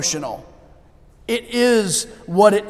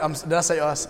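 normal talking voice

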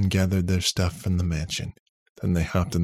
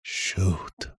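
under his breath. A narrator's reading. Nick realising his programming mistake, under his breath. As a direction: let it slip out under your breath. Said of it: "said under his breath".

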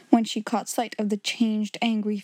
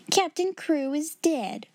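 blunt announcement; 6 semitones higher, slower than usual. A narrator's reading. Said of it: "wasted no words".

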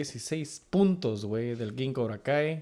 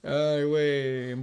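ordinary talk

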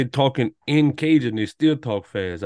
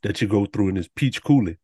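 normal talking voice

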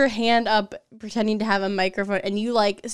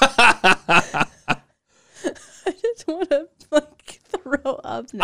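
grossed out; 2 semitones higher, 3 dB louder, much slower than usual. Real, not acted. Grossed out reaction.

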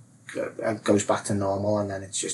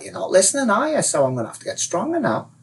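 high, scolding question dropping to resolve; escalating inner advisor; 10 semitones higher than usual. Acted. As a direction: chide the listener for ignoring you. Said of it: "it gets stronger because it's like".

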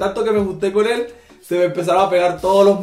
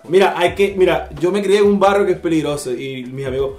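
usual speaking voice

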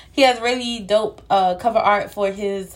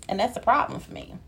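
ordinary talk, nothing marked

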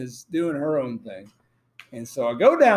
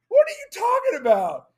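high baffled protest. Retold or imitated. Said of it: "I said".

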